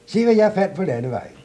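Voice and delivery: high and loud, showing off; proud calling. Acted. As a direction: boast loudly about the find. Klods Hans shows his find.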